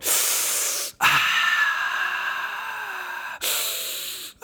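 long high mock wail of pain; imitating a cartoon wail of pain; Peter's pain wail. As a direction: ham up a cartoon dad's scraped-knee moan. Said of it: "he's just going".

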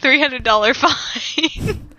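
slow words broken by laughter; giggling through the words; laughing. Pretend. Joking penalty.

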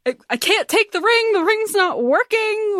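high strained plea; despairing Jamshed. Retold or imitated. Jamshed refusing the ring, high and strained. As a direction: refuse in distress. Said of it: "then he's like".

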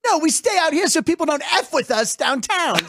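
high-pitched mock indignation; comic, high-voiced angry retort; retold flip-out. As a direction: play an offended rock singer snapping back. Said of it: "And he flipped out".

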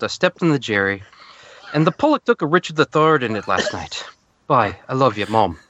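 comic Irish letter-reading; put-on Irish accent. Soldier signing off a letter to Mom, mock Irish accent. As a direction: finish the letter as a hammed-up Irishman. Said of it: "Andrew Scott plays an Irishman".

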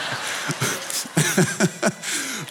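soft laugh at own joke. Real, not acted. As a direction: chuckle over a cheesy line.

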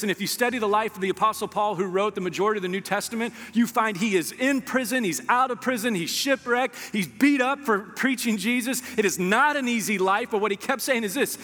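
preaching at full force. Acted. Preacher rallying the church through Paul's hardships, full force. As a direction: preach it, climbing with fire.

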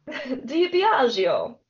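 playful, stretched-out Italian pronunciation; Italian accent. Shown as a demonstration. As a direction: ham up an Italian accent, very slow. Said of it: "say it with an Italian accent".

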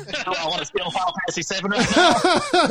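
words spilling through laughter; laughing. Real, not acted. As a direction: laugh your way through the question. Laughing at his own joke.

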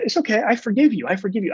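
put-on high, gentle reassurance to a little girl; dad reassuring his ashamed daughter, tenderly; tender forgiveness. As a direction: soothe her, it's okay. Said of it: "And I said".

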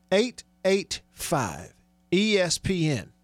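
digit by digit, with gaps; very deliberate radio read-out of the number; slow repeat. Real, not acted. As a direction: spell it out slowly for note-takers.

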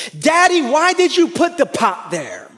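put-on high, accusing little-kid voice; child's accusing voice. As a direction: mimic a small child pointing blame. Child blaming father over pot.

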